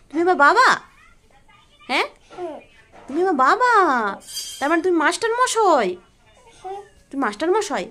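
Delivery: exaggerated lilting question tune. Real, not acted. Teasing questions to a small child.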